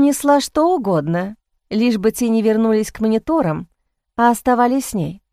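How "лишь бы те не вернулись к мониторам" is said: ordinary delivery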